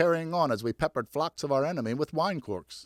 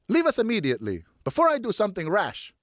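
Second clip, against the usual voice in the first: stern threat; 8 semitones higher than usual. Acted. Mother threatens sailors.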